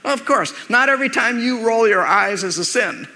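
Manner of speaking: ordinary delivery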